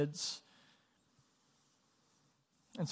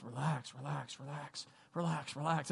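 softly hushed repeated "relax"; calm him down under your breath; a nervous man soothing himself, softly; soothing whisper; 5 dB quieter than usual. Retold or imitated. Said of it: "he goes".

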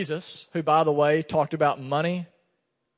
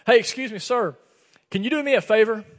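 high, loud, eager plea; the pastor imitates a stranger in a parking lot asking for gas money, high and loud; stranger asking a favor; 7 semitones higher, 8 dB louder than usual. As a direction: call out to a stranger like you need help.

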